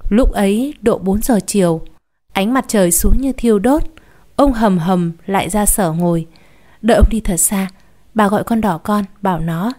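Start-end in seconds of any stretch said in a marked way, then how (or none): none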